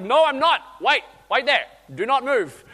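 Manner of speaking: dad calling out across the pool; urgent call. Retold or imitated. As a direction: stop her before she jumps. Father calling to daughter.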